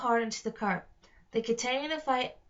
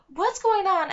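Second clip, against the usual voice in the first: high, loud panicked yell; 9 semitones higher, 8 dB louder than usual. Acted. Alex caught dangling in a rope net, yelling. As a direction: shout it in alarm. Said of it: "Alex yelled".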